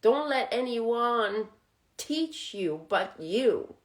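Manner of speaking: normal talking voice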